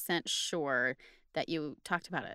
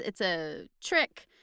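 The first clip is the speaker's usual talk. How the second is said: ordinary delivery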